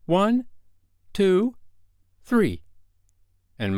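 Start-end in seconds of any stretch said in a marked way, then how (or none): 0.0-2.6 high, strong, spaced countdown; counting child. A narrator's reading. Wendy giving the words to drink, counting. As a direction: count off as a girl giving the signal. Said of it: "Wendy gave the words".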